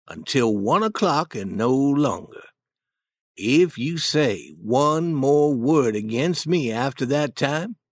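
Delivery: calm, slow voice. Acted. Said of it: "calm, slow voice".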